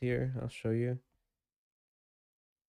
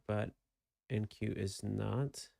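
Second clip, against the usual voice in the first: normal talking voice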